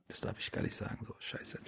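unvoiced whisper, dropped far down; whispered, half to himself, embarrassed; whispered aside. Real, not acted. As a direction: hush it as a secret aside. Aside about something he shouldn't say.

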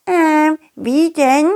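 put-on high, eager child voice; curious little worms. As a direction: ask like a curious kid. Worm kids ask.

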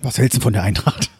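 low dad on the phone; imitated father. Father phoning his son while eating, imitated low Hessian voice. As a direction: drop into Dad's low Hessian voice. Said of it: "Und das geht so".

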